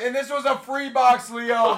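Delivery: excited, emphatic call; hype announcer. Real, not acted. Streamer reminding Leo the box was free, hyped call. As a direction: tell the winner with disbelief.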